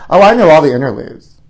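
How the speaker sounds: normal talking voice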